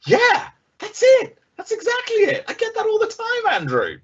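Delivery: mock eager client, squeaky high and gushing. Salesman mimics an eager client on the call, high and gushing. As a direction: gush like a thrilled prospect agreeing. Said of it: "they're going".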